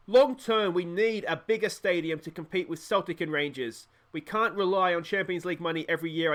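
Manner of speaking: usual speaking voice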